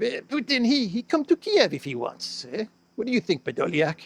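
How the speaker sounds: high-pitched mock Russian-accented president; Zelensky with Russian accent. President asking his aide whether Putin will come, mock Russian accent. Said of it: "he said Kiev with the, with the Russian accent".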